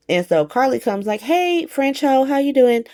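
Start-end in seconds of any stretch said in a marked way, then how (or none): 1.2-2.8 mock greeting. Recapper voices Carly walking up to a rival, high mock greeting. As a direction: imitate an overfriendly greeting, pitched way up.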